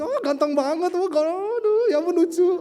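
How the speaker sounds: high, loud mock fangirl gushing; squealing groupie. Groupie swooning as Jesus passes, mock fangirl squeal. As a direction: squeal over a handsome celebrity. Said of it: "Mungkin ada yang grupis".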